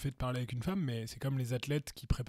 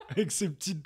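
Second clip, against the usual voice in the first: high, laughing through the words; giggling while talking; 5 semitones higher, 5 dB louder than usual. Real, not acted. Laughing at the Roomba joke.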